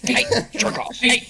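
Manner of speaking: high playful yell at the dog; mock yell at dog. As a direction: call the dog out, hammed-up. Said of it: "yelling at your dogs".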